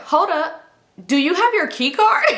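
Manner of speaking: high, pointed questioning; suspicious challenge. Retold or imitated. Suspicious girl confronting the strangers, pointed high question. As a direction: call out the missing key card.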